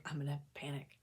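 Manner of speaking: breathy whisper of a fearful inner voice; whispered panic thought. Retold or imitated. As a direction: whisper it to yourself as the panic starts.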